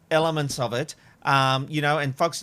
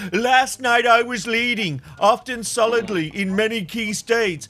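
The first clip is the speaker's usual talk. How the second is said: Trump impression; 5 semitones higher, 3 dB louder than usual. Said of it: "It's the worst impersonation I've ever heard".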